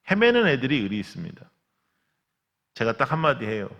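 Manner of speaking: normal talking voice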